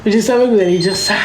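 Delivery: rapid, knowing aside; quick knowing aside. Real, not acted. Telling viewers she expected their reaction, fast. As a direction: rattle it off, amused.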